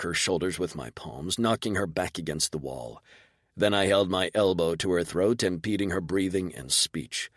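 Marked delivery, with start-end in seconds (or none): none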